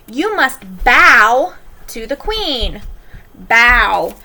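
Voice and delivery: "bow" said to rhyme with cow; slow and deliberate, "bow" as bending; teacher demo, "bow" rhyming with now. Shown as a demonstration. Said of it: "demonstrating homograph sounds".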